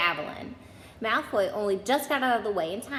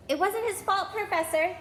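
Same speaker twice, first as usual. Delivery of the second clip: much higher pleading voice; pleading girl; 10 semitones higher than usual. A narrator's reading. Classmate defending Harry to the teacher, high pleading voice.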